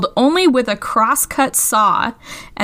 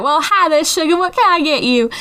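higher-pitched Louisiana belle; Southern belle accent; 5 semitones higher than usual. Retold or imitated. Cashier greeting a gentleman customer, higher Southern belle accent. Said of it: "my Louisiana accent, my Southern belle".

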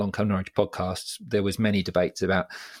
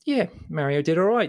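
put-on old boy conceding; 8 semitones higher than usual. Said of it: "he went".

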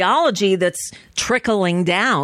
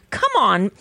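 ordinary talk, nothing marked

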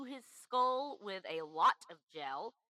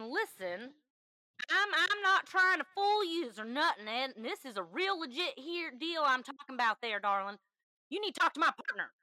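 high, hammed-up Southern accent; bad Southern accent; 6 semitones higher, 4 dB louder than usual. Caller insisting the deal is legit, bad Southern accent. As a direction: lay on a fake Southern twang. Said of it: "One just used a really bad accent".

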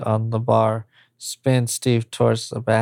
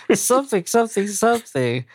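higher, brisk mocking echo; mocking repeat; 8 semitones higher, much faster than usual. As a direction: toss the words back lightly.

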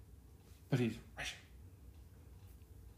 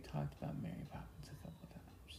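whispered, pitched-up aside; 12 dB quieter than usual. Real, not acted. Husband whispering to wife about repeat topics. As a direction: whisper it like a secret.

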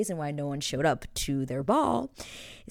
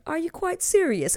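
much higher, incredulous mock voice; mocking Anthony's stunned reply to Kate, pitched way up; imitating Anthony; 14 semitones higher, 4 dB louder than usual. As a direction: squeak the question out in disbelief. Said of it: "It's actually hilarious when he says".

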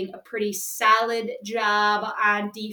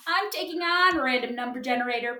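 ordinary talk

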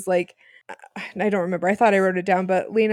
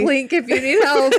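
laughing; 4 semitones higher, 5 dB louder than usual. Retold or imitated. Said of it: "joking quote for sisters".

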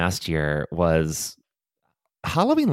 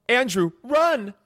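panicked; 14 semitones higher, slower than usual. Acted. Fleeing the killer.

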